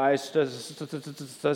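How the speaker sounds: a soft, halting st- st- st- stammer; stuttering. Retold or imitated. Moses stammering excuses to God at the bush, soft stutter. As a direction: stammer out st- over and over.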